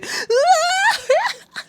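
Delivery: high, loud imitated funeral wail; hammed-up sobbing howl; mock wailing. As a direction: wail theatrically, high and long. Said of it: "She is in tears".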